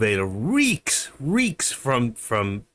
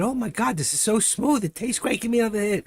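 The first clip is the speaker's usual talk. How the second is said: much higher, delighted mock gush; replaying his own giddy praise of the weed, pitched way up; 11 semitones higher than usual. Retelling a friend's weed hit, he gushes much higher. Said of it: "I said".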